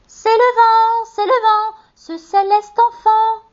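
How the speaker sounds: put-on very high, slow, innocent children's chorus; high childlike voice answering. A narrator's reading. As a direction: pipe up sweetly like small children.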